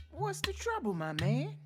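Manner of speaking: neighbor voice starting high; neighbor's question. A narrator's reading. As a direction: ask it as a concerned neighbor. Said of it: "the neighbor asked".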